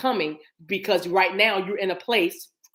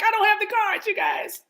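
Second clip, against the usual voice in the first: high-pitched playful complaint; mock helpless whine; 13 semitones higher than usual. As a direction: wail it lightly in a high voice. Mock panic over missing cards.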